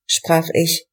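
plain reading voice

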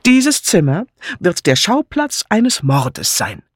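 grand, slow proclamation with a sweeping air; dramatic announcement. A narrator's reading. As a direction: announce a murder like a showman. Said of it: "mit weit ausholender Handbewegung".

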